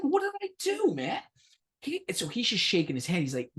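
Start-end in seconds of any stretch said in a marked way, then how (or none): 0.0-1.2 much higher, pleading what did I do; mock outrage. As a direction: act wrongly accused, voice jumping up. Protesting to security guard.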